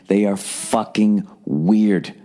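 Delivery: "They are fucking weird." dragged out slowly, "weird" leaned on; slow emphatic verdict. Real, not acted. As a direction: spell it out slowly, stressing "weird".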